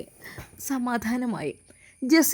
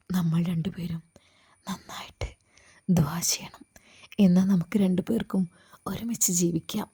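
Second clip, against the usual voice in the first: hushed whisper; 3 semitones lower, 4 dB quieter than usual. Acted. Hijabi coaxing him softly.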